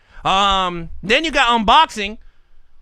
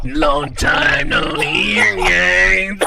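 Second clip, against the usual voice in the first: slow, lowered creep voice; creepy caller; 4 semitones lower, much slower than usual. Retold or imitated. Host imitating a creepy caller, slow and low. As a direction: drag it out like a creep. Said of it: "He'll be like".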